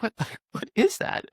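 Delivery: high, laughing, near-whispered "what is that"; a startled giggle at something odd; surprised laughing question. Retold or imitated. Spotting something dangling off the lizard.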